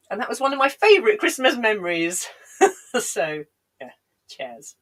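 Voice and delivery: usual speaking voice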